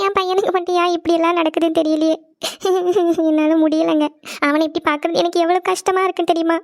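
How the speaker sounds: crying helplessly as a distressed mother; tearful sobbing. Acted. Mother crying to the family over her son's suffering, sobbing. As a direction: sob between the phrases. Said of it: "அழுதுட்டே இருக்காதீங்கம்மா".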